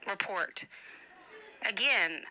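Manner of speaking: ordinary talk, nothing marked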